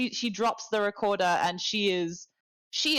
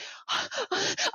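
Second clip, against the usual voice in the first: shocked gasp; 11 semitones higher, 3 dB quieter than usual. Acted. Intern sees the monster.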